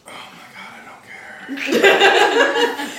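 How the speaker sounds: can't hold it together, laughing out the words. Real, not acted. Player laughing at prisoner's rant.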